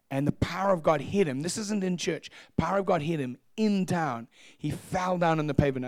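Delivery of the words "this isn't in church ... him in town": ordinary talk, nothing marked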